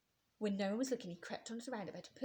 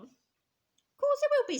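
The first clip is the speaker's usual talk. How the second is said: put-on much higher, louder, slow children's chorus; loud reassurance; 13 dB louder, much slower than usual. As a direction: kids insisting the seesaw is safe. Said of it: "said the others".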